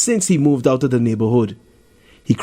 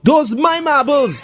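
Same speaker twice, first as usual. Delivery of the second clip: high, slow mild mock shout; understated boy's cry; 13 semitones higher, slower than usual. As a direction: call out over a crowd, a touch strained. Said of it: "Darren shouts".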